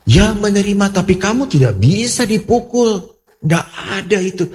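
high, emphatic retelling of a protest; indignant objection. Retold or imitated. Pastor objects to the woman accepting being hit, indignant. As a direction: push back firmly, pitch jumping up.

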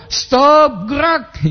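high-pitched mock drill command; aba-aba baris-berbaris. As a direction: call out a parade order in a high voice. (aba-aba komandan bernada tinggi)

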